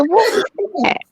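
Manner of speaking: high, fast startled outcry. Retold or imitated. Retelling jumping at a noise, high fast startled outcry. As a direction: jump and ask where the noise came from. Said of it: "I jumped. I said".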